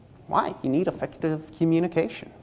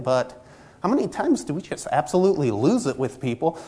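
ordinary talk, nothing marked

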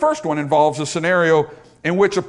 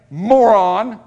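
loud, raised-pitch name-calling; acted angry insult; 4 semitones higher, 7 dB louder than usual. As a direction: throw it out as a mock-angry insult. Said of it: "It's become so angry".

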